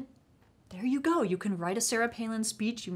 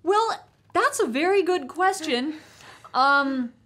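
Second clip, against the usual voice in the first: mock polite; 8 semitones higher, 7 dB louder than usual. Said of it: "So I'm like".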